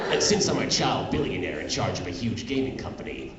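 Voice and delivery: ordinary talk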